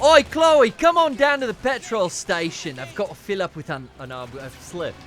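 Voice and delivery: mock-Aussie call, loud then tailing off; Australian accent. Shown as a demonstration. Host inviting guest to the petrol station, mock Australian. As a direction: open with a big Aussie holler, then falter. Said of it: "Do your best Australian accent".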